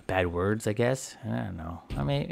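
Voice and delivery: normal talking voice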